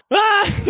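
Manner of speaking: high-pitched imitated cry; imitated yelp of a friend collapsing; sudden high mock scream. Retold friend cries out, collapsing.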